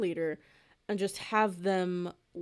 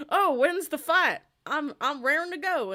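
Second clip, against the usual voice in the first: high, loud, lightly voiced eager cowboy; a hint of a cowboy keen to fight; eager cowboy robot; 8 semitones higher, 7 dB louder than usual. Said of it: "he's like".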